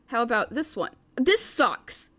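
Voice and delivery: ordinary talk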